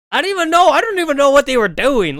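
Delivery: high, loud disbelief; incredulous outburst. Real, not acted. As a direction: blurt it out, still amazed.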